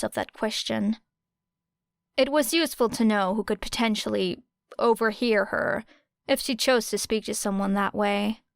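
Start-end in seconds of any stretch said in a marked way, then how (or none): none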